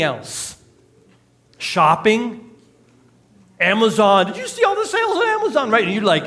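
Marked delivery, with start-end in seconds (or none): 4.3-5.7 high, fast, excited mock shopper voice; gushing shopper. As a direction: squeal it like a bargain hunter who just found sales. Mock shopper gushing over sales.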